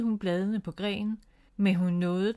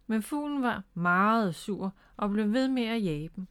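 plain reading voice

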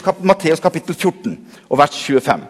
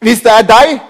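doubtful Peter; 6 semitones higher, 6 dB louder than usual. Acted. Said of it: "Og så kommer liksom Peter".

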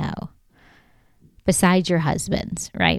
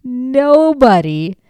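Nobody, drawn out slow, high and louder; stress on nobody; 6 semitones higher, 5 dB louder, slower than usual. Real, not acted. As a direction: stretch Nobody out in disbelief. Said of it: "meaning nobody has been smarter".

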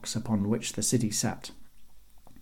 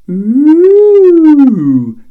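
long high, loud "ooh"; drawn-out jeering hoot; mocking ooh; 17 dB louder than usual. A narrator's reading. Guards reacting to the thrown axe, jeering ooh. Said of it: "came the voices from above".

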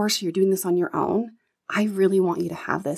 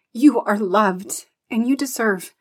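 getting emotional; 2 semitones higher, 5 dB louder than usual. Real, not acted. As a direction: let the feeling break through as you say it. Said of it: "Getting emotional".

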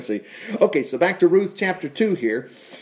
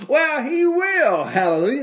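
emphatic assurance; 10 semitones higher than usual. Real, not acted. Preacher assures congregation the Lord will provide, excited. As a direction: stretch it out high with conviction. Joyfully answers his own wish.

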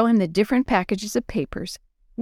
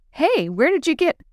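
higher-pitched surprised question; 8 semitones higher than usual. A narrator's reading. Willie sees his friends' candy bars, surprised. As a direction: blurt it out, puzzled and cut off.

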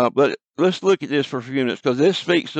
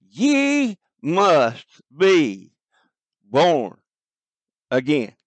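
very slow, each word set apart with long pauses; emphatic, drawn out; much slower than usual. Shown as a demonstration. Preacher reading Jesus' words to listeners, slow and emphatic. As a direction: lay the words down one by one, with weight. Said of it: "We hear a great emphatic here".

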